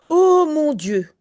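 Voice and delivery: high, loud anguished exclamation; despairing cry. Acted. Mother's despairing cry, read aloud.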